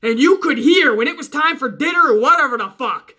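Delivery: usual speaking voice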